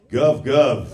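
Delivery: loud, low dog bark in Russian; scary Russian bark. Acted. Showing how dogs bark in Russia, scary Russian bark. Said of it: "everything sounds scary with a Russian accent".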